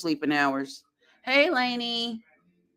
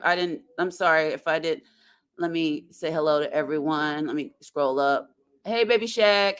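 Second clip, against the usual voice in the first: ordinary talk, nothing marked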